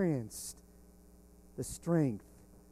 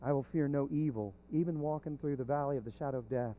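soft assurance; 2 semitones lower, 6 dB quieter than usual. Retold or imitated. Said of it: "And yet he said".

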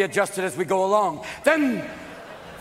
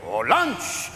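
raised, animated French protest; French accent; 5 semitones higher than usual. Acted. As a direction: argue high and lively with a French accent. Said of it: "the French would be going".

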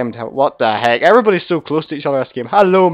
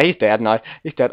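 normal talking voice

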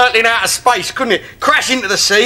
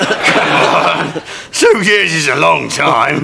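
low seductive come-on; 6 semitones lower, slower than usual. Acted. Continued suggestive pleading.

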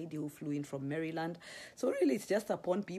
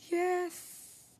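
mock gasp; 14 semitones higher than usual. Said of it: "I'm not gonna talk like that".

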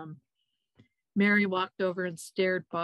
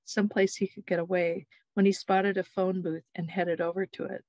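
ordinary delivery, nothing marked

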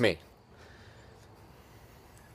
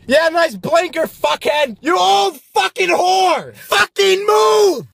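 yelling much higher and louder, building to a slow furious shout; road rage; 10 semitones higher, 5 dB louder, slower than usual. Acted. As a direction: scream at the other drivers through the windshield. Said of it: "This is me when I drive".

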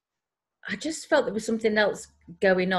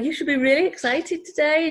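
higher and louder, bursting with excitement; dead excited; 9 semitones higher, 4 dB louder than usual. Retold or imitated. Urging the young girl, thrilled and high. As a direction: lift it right up, thrilled for her. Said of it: "I was dead excited for her".

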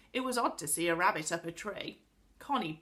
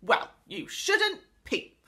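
slow, loud, high scolding; enraged cry; 12 semitones higher, 7 dB louder, slower than usual. A narrator's reading. Angry pixie blames the peeper, in a rage. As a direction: scold in a rage, drawing out the words. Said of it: "cried the angry pixie, still in a rage".

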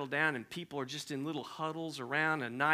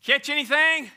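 loud, higher call across water; hollering; 10 semitones higher, 10 dB louder than usual. Acted. Jesus to fishermen on shore, loud holler. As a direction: shout to the boat. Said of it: "Jesus hollers at him".

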